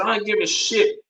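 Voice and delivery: high, dismissive snap; defiant retort. Retold or imitated. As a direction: brush off the warning with a scoff. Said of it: "I said".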